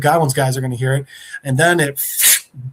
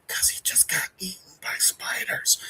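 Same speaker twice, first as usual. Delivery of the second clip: hushed villain's whisper; menacing whisper; 2 semitones higher, 11 dB quieter than usual. Retold or imitated. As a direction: drop into a sinister imitated whisper. Goblin's menacing reveal, whispered.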